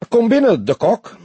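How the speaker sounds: high, affected summons; put-on high voice, affected and formal; affected commissaris. A narrator's reading. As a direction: call him in with affected airs. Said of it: "sprak hij geaffecteerd".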